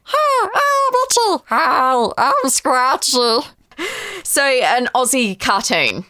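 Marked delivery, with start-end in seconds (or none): 0.0-1.4 high squeaky cartoon mouse voice; cartoon mouse introducing himself; Itchy. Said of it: "I'm gonna do Itchy and Scratchy". 1.5-3.5 slow cartoon cat voice; drawn-out cartoon cat greeting; a hint of a cartoon cat; Scratchy. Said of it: "I'm gonna do Itchy and Scratchy".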